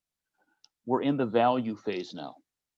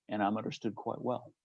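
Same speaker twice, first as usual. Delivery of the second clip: softer, wry throwaway repeat of understood quite well; quiet knowing echo; dry quiet repeat; 8 dB quieter than usual. Real, not acted. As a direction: drop the volume and let and I'm understood quite well land as a dry aside. Repeated line about being understood.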